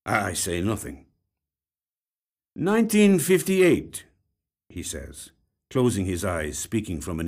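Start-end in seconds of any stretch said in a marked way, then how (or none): 2.5-4.1 slow, higher and louder; lightly voiced detective dredging up a date; detective recalling. A narrator's reading. Detective recalling the old case, slow and raised.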